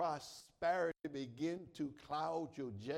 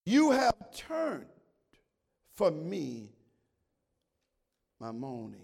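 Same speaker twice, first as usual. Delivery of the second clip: preaching; 10 dB louder, slower than usual. Acted. Preaching at full force.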